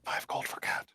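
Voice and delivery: very soft, voiceless whisper; hushed haggling; whispered offer. Acted. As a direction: whisper a secret bid. Whispered haggling during stealth scene.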